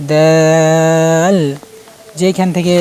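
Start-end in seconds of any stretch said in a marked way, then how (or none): 0.1-1.4 louder, level chanted দাল; drawn-out letter demo. Shown as a demonstration. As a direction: hold the vowel of দাল very long, then close on l. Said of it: "আমি এত লম্বা করছি না".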